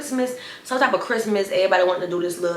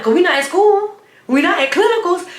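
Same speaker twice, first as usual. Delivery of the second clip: loud and high, squaring up to her; loud challenge; 11 semitones higher, 7 dB louder than usual. Retold or imitated. Narrator yelling at classmate to come outside, loud challenge. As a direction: call her out, we're not at school.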